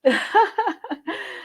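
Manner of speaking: bright high laugh; host laughing about running over time. Real, not acted.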